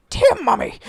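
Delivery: high-pitched, scratchy Cockney woman; loud, high Cockney cry; Patty Boyd parody. Shown as a demonstration. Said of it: "with a Cockney accent, and it, it's a little bit like".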